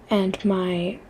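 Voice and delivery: dropping to a mock-dramatic whisper. Shown as a demonstration. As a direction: hush it like a dramatic confession. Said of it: "okay, sorry".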